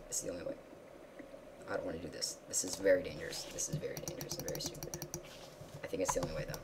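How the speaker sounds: soft nervous whisper; quiet fearful mutter; hushed dread. Real, not acted. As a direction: whisper the risk.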